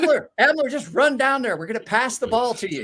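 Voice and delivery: high, loud calling across the court; teammates calling out. Retold or imitated. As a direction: shout it high like a teammate waving you down. Voicing teammates calling him.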